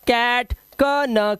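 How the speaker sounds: कैट drawn out slowly with the ae vowel; teacher spells the sound out slowly; slow demo words. Shown as a demonstration. Teacher slowly sounding कैट for students. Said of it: "अब इसी तरह से ये हो गया कप".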